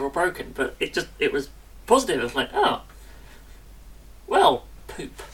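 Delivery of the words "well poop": ordinary delivery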